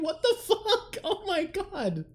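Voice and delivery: floored by the gift, laughing; shocked disbelief. Real, not acted. Huge gift surprise on stream.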